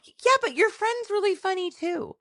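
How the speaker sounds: mom's enthusiasm in a very high voice; Mary Catherine impression. Imitating mom praising the podcast co-host, high-pitched enthusiasm. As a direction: pitch it way up as an admiring mother. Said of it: "then she's like".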